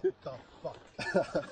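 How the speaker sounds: put-on slow, drawn-out Norwegian accent. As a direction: stretch it out in a thick Norwegian accent. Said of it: "in that thick Norwegian accent".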